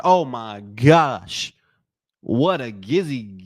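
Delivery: slow, drawn-out oh my gosh; slow amazed groan of disbelief; stunned. Real, not acted. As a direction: let it out in disbelief. Stunned reaction to a shot.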